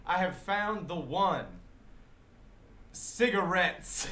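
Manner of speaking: imitated street ranting. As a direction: proclaim it slowly to the sky. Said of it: "cracked out, and he's like".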